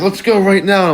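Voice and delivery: mock challenge. Guy takes up the kid's fight offer, mock challenge. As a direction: lightly act out egging someone on.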